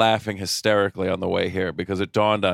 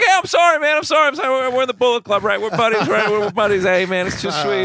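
high, loud fake-sorry wrestler voice; hammed-up pleading to a buddy; mock innocent apology; 12 semitones higher, 4 dB louder, faster than usual. Anderson pleading with his partner, high and hammed-up.

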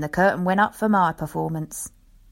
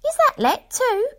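much higher, louder wife's voice; astonished wife; 5 dB louder than usual. Acted. Wife amazed the box is let, high voice. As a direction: ask it high in disbelief. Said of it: "cried the missus".

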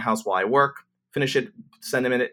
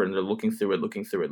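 ordinary talk

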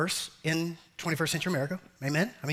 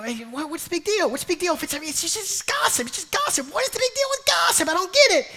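much higher, squeaky whine; hammed-up petulant complaint; mock whiny gossiper; 14 semitones higher, 4 dB louder than usual. Preacher mimics a gossiper whining to God.

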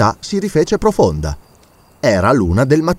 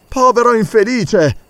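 pitying hunter; 10 semitones higher than usual. Acted. Hunter pities the prisoner.

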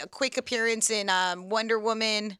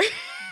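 high squealing laugh; 8 dB quieter than usual. Real, not acted. Laughs after retold tweet.

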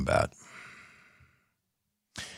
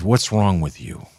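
normal talking voice

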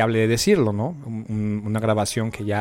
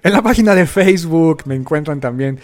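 louder retake; 3 semitones higher, 7 dB louder than usual. Real, not acted. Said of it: "Perdón, perdón".

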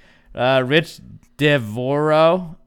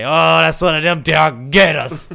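high, loud Southern twang; put-on Southern accent; 5 semitones higher, 8 dB louder than usual.

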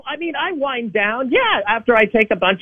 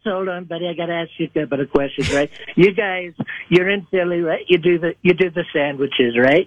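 lower, boastful Trump voice; Donald Trump impression; 3 semitones lower than usual. A caller playing Donald Trump for the hosts, boastful and lower. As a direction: brag like Trump on a rally stage. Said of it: "It's Donald Trump".

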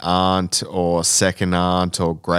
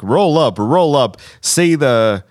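circus barker; 6 semitones higher, 3 dB louder, slower than usual. Pretend. Mock circus barker call.

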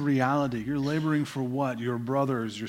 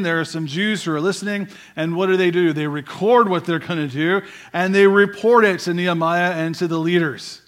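rising, louder sermon climb; full-force preaching; 3 semitones higher, 7 dB louder than usual. Acted. Pastor preaching to his church, loud and rising. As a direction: drive the point home to the congregation.

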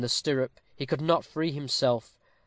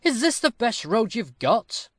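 high-pitched, indignant outcry; crying out; 9 semitones higher, 4 dB louder than usual. A narrator's reading. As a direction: demand it in a raised, much higher voice. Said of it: "cried Turpin".

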